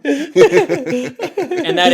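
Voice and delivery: cracking up while announcing the dish; giggly mock menu announcement; laughing.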